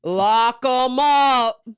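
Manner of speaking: very slow, drawn-out Southern order; slow mocking lock 'em up. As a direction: drag it out like a smug sheriff. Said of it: "the guy just goes".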